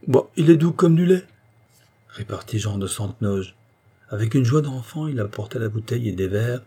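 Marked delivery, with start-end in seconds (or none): 0.1-1.3 loud, high, jovial insistence; hearty coaxing. A narrator's reading. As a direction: brush off the worry cheerfully. Host cheerfully coaxes the reluctant girl.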